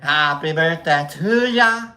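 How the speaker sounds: loud, slow sung line in a bad Aussie accent; bad Australian accent. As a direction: belt it slowly in a broguish Aussie accent. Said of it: "that's a little broguish".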